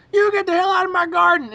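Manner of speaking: high, loud furious yell; angry yelling old man. Retold or imitated. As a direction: bellow at the kids to get off your lawn. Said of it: "the guy in the garden".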